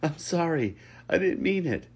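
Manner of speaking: very high, loud apology; playful pleading. A narrator's reading. Lucas caught while splashing, pleading playfully. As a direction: plead loudly in play.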